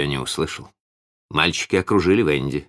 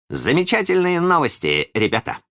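high, merry announcement; lively high-pitched delight; cheerful; 11 semitones higher than usual. A narrator's reading. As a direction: share great news gleefully. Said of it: "весело сказал он".

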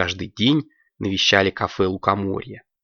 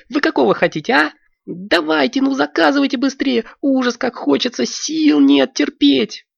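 high, squeaky and excited; a high child's voice begging to order fast; eager little girl; 5 dB louder than usual. Acted. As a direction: bounce with impatience for ice cream.

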